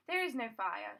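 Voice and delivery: ordinary delivery, nothing marked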